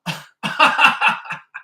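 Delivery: loud, high burst of laughter; real laugh. Real, not acted. Meditation host laughing at his own understatement, amused laugh.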